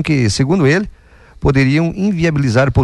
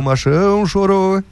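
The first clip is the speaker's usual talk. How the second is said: teasing, pitched up high; mocking taunt; 6 semitones higher than usual. Real, not acted. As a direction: sneer at the tough guy who cried. Repeats the taunt higher.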